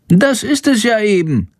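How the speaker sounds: plain reading voice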